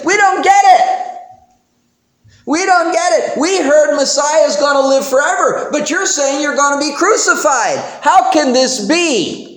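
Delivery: the puzzled people pleading with Jesus; exasperated crowd. Retold or imitated. Crowd voiced in confusion.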